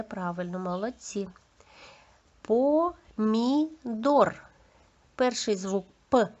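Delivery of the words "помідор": "Помідор" very slowly, syllable by syllable; three separate drawn-out beats in "Помідор", higher than normal speech; sound-by-sound demo. Shown as a demonstration. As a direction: break "Помідор" apart for pupils. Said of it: "зробимо звуковий аналіз слів".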